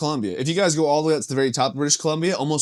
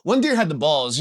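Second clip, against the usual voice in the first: ordinary talk, nothing marked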